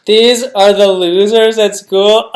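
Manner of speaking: can't believe these are the losers; incredulous disbelief. Real, not acted. Shocked at show's "losers.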